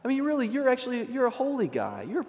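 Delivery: high, soft placating voice; butter him up in a small high voice; mock coaxing reassurance. Seraph imagined flattering Isaiah, high soft mock reassurance.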